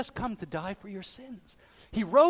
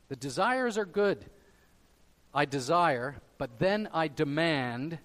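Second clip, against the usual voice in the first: ordinary talk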